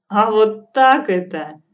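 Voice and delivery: loud, slow gleeful retort; old witch enjoying herself, loud and jolly; merry. Acted. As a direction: tease her with delight. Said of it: "ещё больше завеселилась старуха".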